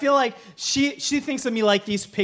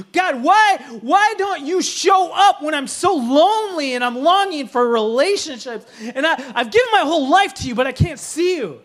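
anguished shouted prayer; 2 semitones higher, 3 dB louder than usual. Retold or imitated. Preacher shouting her prayer at God, pleading and loud. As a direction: hurl the questions at heaven, building.

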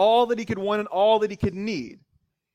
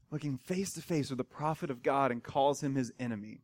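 ordinary delivery

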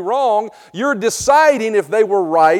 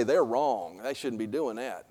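mock judgmental voice; 10 semitones lower, 11 dB quieter than usual. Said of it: "and we say".

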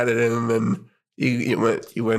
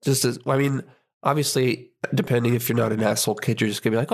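usual speaking voice

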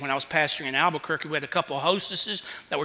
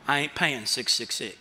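deep penetrating voice; 7 semitones lower, 5 dB quieter than usual. Retold or imitated. Man at the register refusing to pay, deep penetrating voice. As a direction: drop the voice low and menacing. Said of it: "in a deep, penetrating voice".

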